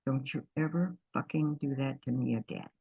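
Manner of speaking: low hushed threat in her ear; quietly hissed warning to her partner; retold whisper. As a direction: mutter it low and hard, not a real whisper. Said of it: "I whispered in her ear".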